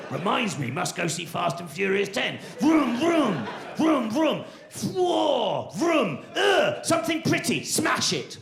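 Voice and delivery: lampoon a gym-going alpha male; macho bloke parody. Retold or imitated. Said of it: "This whole kind of like, you know, the whole".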